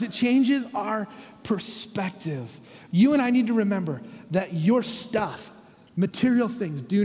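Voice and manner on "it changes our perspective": slow emphasis. Acted.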